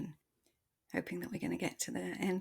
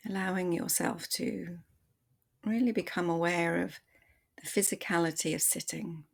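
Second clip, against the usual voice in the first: calm body-scan voice, softer than the intro; guided meditation; 4 dB quieter than usual. Acted. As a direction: ease the listener into their body, gently.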